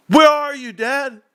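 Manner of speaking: put-on high, loud kid yelling for Dad; high-pitched mock yell at Dad; imitated yell. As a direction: yell it like an indignant son calling out. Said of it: "then he'd yell at me".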